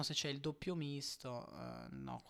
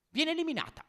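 breathless italian goal commentary; 12 semitones higher, 6 dB louder, faster than usual. Real, not acted. Shock upset result announced.

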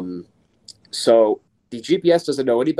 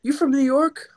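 excited cop. Retold or imitated. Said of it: "He's like".

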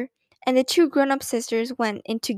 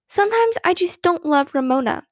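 higher-pitched, mildly urgent confession; blurted out; 6 semitones higher than usual. A narrator's reading. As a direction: blurt it out to get it over with. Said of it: "she blurted out to get it over with".

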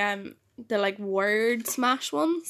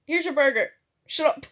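brusque dad; 5 semitones higher, 3 dB louder, faster than usual. Retold or imitated. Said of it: "I was like".

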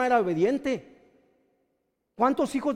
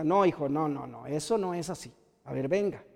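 low, soft parental no; gentle correction; 8 semitones lower, 6 dB quieter than usual. Acted. Parent teaching a son justice, gentle correction. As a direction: correct the child quietly.

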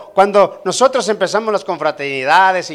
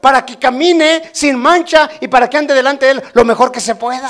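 shouted sermon questions; 6 semitones higher, 4 dB louder than usual. Acted. Preacher shouting rhetorical questions.

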